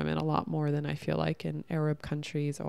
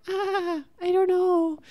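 much higher, meek and unsure voice; timid little lamb; 13 semitones higher, slower than usual. Retold or imitated. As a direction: play the helpless easy prey. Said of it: "limp little lamb that's just like".

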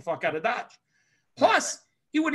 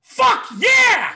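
very high, loud victory yell; triumphant shout; 9 dB louder than usual. Retold or imitated. Teen jumping up after passing the exam, triumphant shout. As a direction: let it explode like a win.

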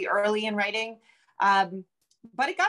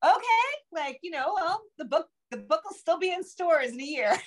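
much higher, light and airy mock reply; breezy reassurance; 10 semitones higher than usual. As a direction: brush off the critics with a cheery shrug. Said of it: "I go".

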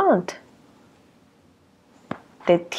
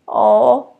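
ordinary delivery

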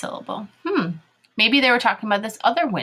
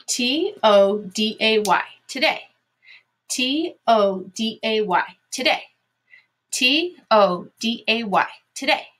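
letters spaced out, then the whole word; slow spelling drill; slower than usual. Shown as a demonstration. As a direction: classroom spelling chant. Teacher spells words aloud three times.

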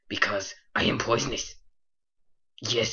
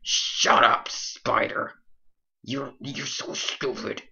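angry snap; slower than usual. Acted. Snaps at spider mount.

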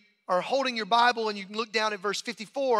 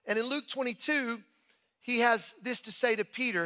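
ordinary talk, nothing marked

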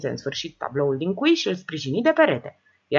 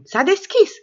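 higher, bright cry of success; excited exclamation; 12 semitones higher, 7 dB louder, slower than usual. Acted. Sile tells the room the safe opened, excited cry. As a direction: announce it in triumph. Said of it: "exclamând".

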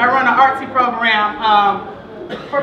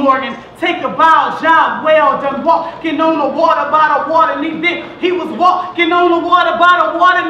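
driving rhythmic repetition, building in pitch; spoken-word poem; 4 semitones higher, 4 dB louder than usual. Acted. Poet honoring her late preacher papa, building spoken-word sermon. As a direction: build it like a preacher nearing the climax.

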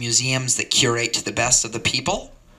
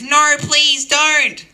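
much higher, louder, slow plea; hammed-up old lady pleading; 6 dB louder, much slower than usual. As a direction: beg them like a frail old lady. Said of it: "And she says".

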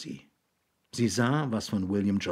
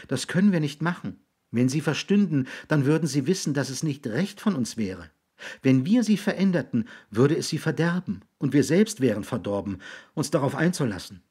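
put-on higher-pitched voice of a boy; earnest boy; 5 semitones higher than usual. Jix explains to William why they watch him, earnest boy.

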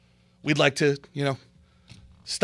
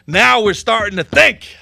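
much louder, slower and higher; emphatic approval; 5 semitones higher, 11 dB louder than usual. Real, not acted. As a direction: proclaim it with relish. Repeats phrase louder second time.

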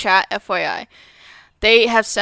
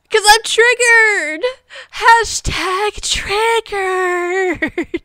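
half-hearted fake sob, higher and drawn out; mock crying; 7 semitones higher, much slower than usual. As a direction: pretend to cry over being triggered. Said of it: "I'm gonna start crying".

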